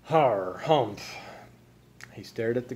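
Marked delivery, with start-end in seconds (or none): 0.0-1.1 drawn-out throat-rumbling harrumph; grumpy harrumph. A narrator's reading. Frizzle gives in to Gus, harrumphing. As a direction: harrumph it in a lowered voice. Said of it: "He lowered his voice, too".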